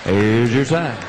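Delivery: low, weighted on sign; catchphrase. Acted. Comic to audience, low deadpan. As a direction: deliver it low and dry.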